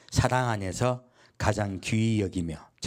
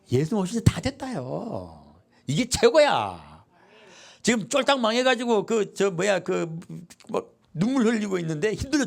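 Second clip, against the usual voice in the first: mock consolation; 8 semitones higher than usual. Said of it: "어떤 말로 위로할까요?".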